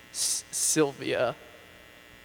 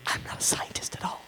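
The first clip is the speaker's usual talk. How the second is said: whispering. Acted. Whispered confession.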